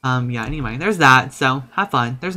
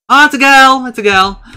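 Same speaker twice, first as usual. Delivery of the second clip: excited shout; 11 semitones higher, 12 dB louder than usual. Real, not acted. Gender reveal excitement.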